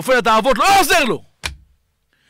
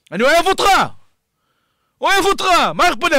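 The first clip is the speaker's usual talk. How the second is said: shouting; 6 semitones higher, faster than usual. Real, not acted. Preacher to a drug-using listener, impassioned shout. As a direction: shout it out with urgency. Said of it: "אז למה אני צועק?".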